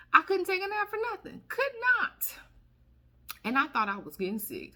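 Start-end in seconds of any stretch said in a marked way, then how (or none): 0.1-2.4 much higher, fast insistence; high emphatic complaint. Real, not acted. Can't nap anymore, high emphatic protest.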